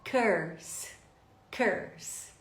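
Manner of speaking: "curse" with er as in her; isolated "curse", long hissed s; model of highlighted word. Shown as a demonstration. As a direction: model "curse" with a central vowel. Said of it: "E a palavra em destaque".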